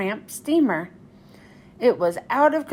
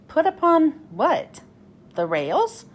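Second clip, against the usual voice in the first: confused question; 5 semitones higher than usual. Acted. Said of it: "Thomas was confused".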